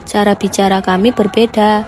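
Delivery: normal talking voice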